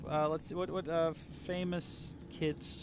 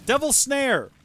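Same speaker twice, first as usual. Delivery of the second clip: excited; 12 semitones higher, 10 dB louder, slower than usual. Real, not acted. Suddenly remembers the name.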